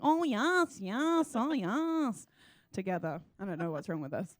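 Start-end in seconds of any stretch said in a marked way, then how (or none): 0.0-2.3 swooping, high-pitched Liverpool parody; hammed-up sing-song Liverpool "yes"; fake Liverpool accent. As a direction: mimic the manager's Scouse, pitched up and louder. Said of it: "we just all sit in a car together like".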